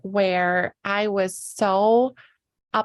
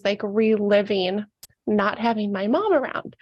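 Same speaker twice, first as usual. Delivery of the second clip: ordinary talk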